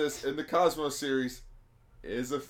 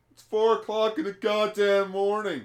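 higher, louder mock outrage; exasperated retort; 8 semitones higher, 5 dB louder than usual. Royal planet answers a dawn invasion threat, exasperated retort. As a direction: protest it loudly, pitched up.

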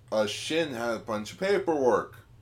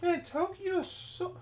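put-on scared old lady voice; 3 dB quieter than usual. Mother voiced in story.

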